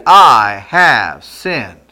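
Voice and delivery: I have sinned, each word spaced and slow; slow, drawn-out confession. Shown as a demonstration. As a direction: lay it out word by word, slowly. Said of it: "Say it with me".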